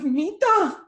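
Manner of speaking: a choked-up, tearful name; tearful call. Retold or imitated. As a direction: call her name through tears. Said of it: "she just starts bawling".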